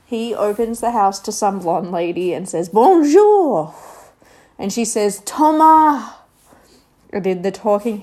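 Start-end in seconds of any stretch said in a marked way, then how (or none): 2.7-3.7 high, loud hammed-up French "Bonjour" with a bad accent; mock French greeting. Thomas greeting the blonde lady, a mock French accent. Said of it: "His accent is horrific". 5.2-6.1 high, loud hammed-up Frenchwoman saying "Thomas"; mock French lady. French teacher answering Thomas at the door, mock French voice.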